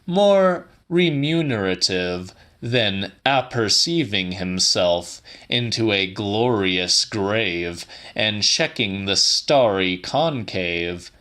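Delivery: plain reading voice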